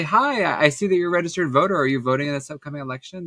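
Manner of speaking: rattled off fast like a rehearsed doorstep script; quick canvassing spiel. Retold or imitated. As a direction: rush through the voter question. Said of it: "I would say".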